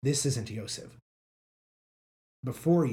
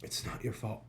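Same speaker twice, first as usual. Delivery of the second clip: faint, barely voiced reassurance; soft, failing whisper; weak wounded voice; 3 semitones lower, 10 dB quieter than usual. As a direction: breathe it out like an injured man. Wounded Roni reassures his friend.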